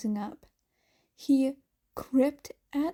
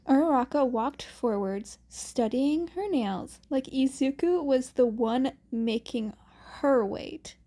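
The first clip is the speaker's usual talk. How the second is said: usual speaking voice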